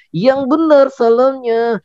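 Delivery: slow, high little puppet echoing the host. Acted. Puppet Odi parroting Kak Danang, high and slow. Puppet repeats host's words.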